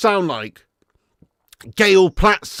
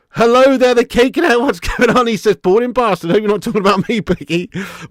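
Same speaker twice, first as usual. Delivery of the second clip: loud mock greeting; 4 semitones higher, 6 dB louder than usual. Voicing someone greeting loudly.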